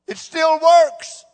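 high, loud, slow proclamation; fervent preaching. Acted. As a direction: preach it with full force.

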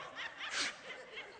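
soft unvoiced laugh into the mic; quick silent laugh at himself; breathy chuckle. Real, not acted. Comedian chuckling softly at his own joke.

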